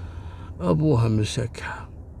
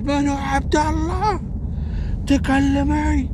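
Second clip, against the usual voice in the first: much higher, slow menacing interrogation; furious demand; 11 semitones higher, slower than usual. Retold or imitated. Husband grilling his wife about Abdullah, furious and slow. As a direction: demand the name, drawn out.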